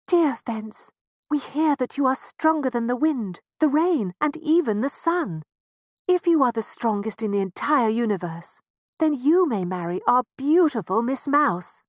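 high, loud eager mouse parent; a lightly voiced, eager mouse parent. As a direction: pitch your daughter to the fence. Parents court the fence.

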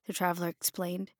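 plain reading voice

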